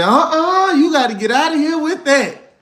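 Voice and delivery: high, loud alarmed woman voice; scared mothers. Retold or imitated. Women at the party shooing him away, high alarmed voice. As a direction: shoo him off like a frightened mother. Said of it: "That woman said".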